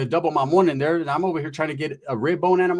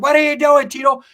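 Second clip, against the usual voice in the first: high loud yell; dad yelling; 11 semitones higher, 8 dB louder than usual. Retold or imitated. Angry dad yelling at son in pool, high yell. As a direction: yell it from the window.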